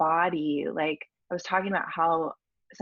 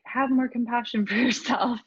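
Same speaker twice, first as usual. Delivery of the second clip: laughing quote; 6 semitones higher, 3 dB louder than usual. Retold or imitated. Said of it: "it's so easy to say".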